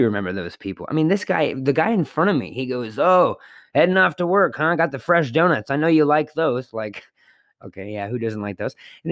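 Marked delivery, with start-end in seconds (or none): 3.0-6.7 chatty customer. Retold or imitated. Chatty customer quoted cheerfully.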